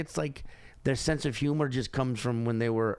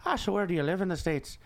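put-on chatty Irish-accented cabbie's question; Irish cab driver; 3 semitones higher than usual. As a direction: imitate a Dublin driver's Irish lilt. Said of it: "the cab driver was just like".

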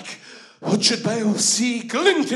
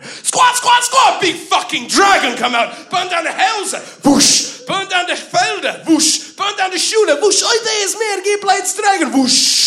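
much higher shouted whooshes and cries; dragon attack shouts; 8 semitones higher, 4 dB louder than usual. Acted. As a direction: blast each whoosh like fire sweeping the hall. Dragon attacks and burns halls.

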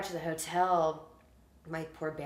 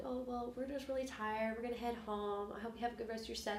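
higher, softer, quick polite farewell; imitating fans; 5 semitones higher, 10 dB quieter than usual. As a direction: lightly mimic tired fans leaving early. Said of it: "they're like".

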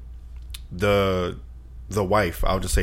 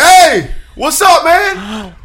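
high, slow, louder easygoing uncle; lightly voiced laid-back uncle; uncle's casual greeting; 7 dB louder, much slower than usual. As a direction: nonchalant uncle checking in.

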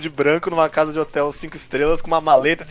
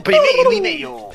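much higher, slow squeaky robot; toy robot voice; 4 dB louder, slower than usual. Pretend. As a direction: announce like a tiny toy robot. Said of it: "Não precisa fazer isso pra todos".

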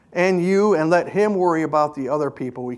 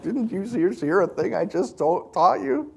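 softer, half-amused exasperation; a hint of a patient teacher losing patience; exasperated Jesus; 5 dB quieter than usual. Jesus to Peter, mildly exasperated. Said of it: "You can just kind of see where Jesus, like".

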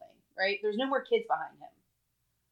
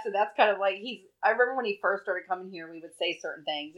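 ordinary talk

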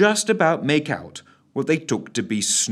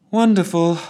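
sighed; 8 semitones higher, 5 dB louder than usual. A narrator's reading. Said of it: "sighed Ellen".

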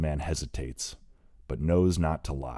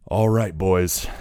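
put-on loud call to distant boys; shouting; 4 semitones higher, 9 dB louder, slower than usual. A narrator's reading. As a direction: call out across the cliffs. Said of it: "he shouts".

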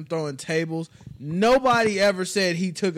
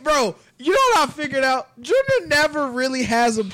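loud opinionated ribbing; 7 semitones higher, 5 dB louder than usual. Real, not acted. Loud ribbing about Junior.

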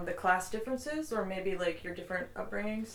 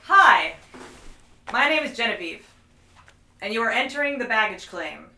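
podcast host intro; 7 dB louder than usual. Acted.

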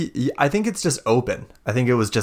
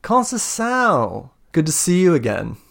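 villain's greeting; 7 semitones higher than usual. Acted. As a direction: greet an old foe with mock pleasure, pitched up. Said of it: "And she, he goes".

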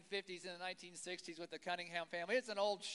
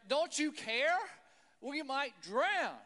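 louder, slow, pleading reproach; desperate cry; 4 semitones higher, 6 dB louder, slower than usual. Retold or imitated. Frightened disciples ask Jesus if he cares, loud and desperate. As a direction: demand he notice the danger.

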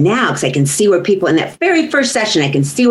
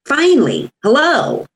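exasperated finally; 8 semitones higher than usual. Real, not acted. Relief at insurance coverage.

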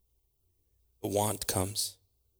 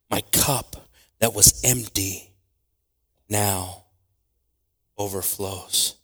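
ordinary talk, nothing marked

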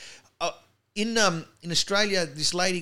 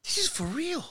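much higher, stunned disbelief; retold amazement; 13 semitones higher than usual. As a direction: gasp it out like big news. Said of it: "I'm going".

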